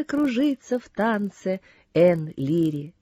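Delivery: plain reading voice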